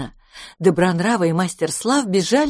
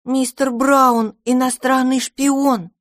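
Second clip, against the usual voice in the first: slow, proud announcement; 7 semitones higher, 4 dB louder, slower than usual. Acted. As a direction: say it with pride. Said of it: "гордо ответил".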